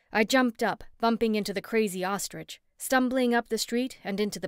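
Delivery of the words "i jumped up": usual speaking voice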